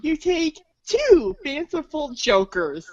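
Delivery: usual speaking voice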